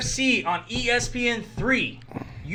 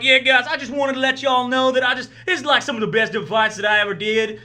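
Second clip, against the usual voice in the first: put-on Texas accent; 5 semitones higher, 4 dB louder than usual. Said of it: "He has a super thick Texas accent".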